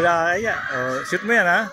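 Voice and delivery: ordinary delivery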